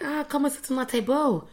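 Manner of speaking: high-pitched friendly invite, acted out; welcoming invitation. Friendly girls invite newcomers to their table, high and welcoming. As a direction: imitate girls eagerly calling you over.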